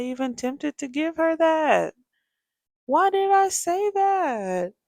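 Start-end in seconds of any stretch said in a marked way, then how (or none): 2.9-4.7 high, loud, slow self-scolding; exasperated at herself. Real, not acted. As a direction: wail it at yourself in disbelief. Mother berating her own promise.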